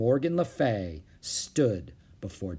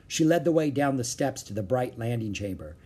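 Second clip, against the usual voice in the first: plain reading voice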